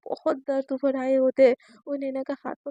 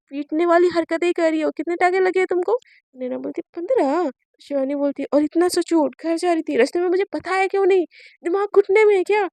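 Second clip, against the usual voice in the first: angry scolding; 3 semitones higher, 7 dB louder than usual. Acted. Scolding after fifteen stitches.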